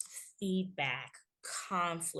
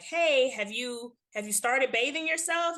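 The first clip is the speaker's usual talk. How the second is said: irritated call; 6 semitones higher, 7 dB louder than usual. Retold or imitated. Mom calling to son in bath.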